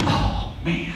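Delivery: whispered worry to himself; whispered thought. Acted. Iguana George thinks anxiously before the shark waters, whispered. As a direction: breathe it like a thought. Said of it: "George is thinking".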